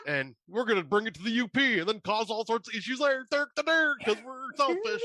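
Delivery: high, sneering mock voice of careless people; laughing parody of selfish rule-breakers; mocking selfish campers. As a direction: lampoon them as smug idiots while laughing. Mocking reckless campers continued.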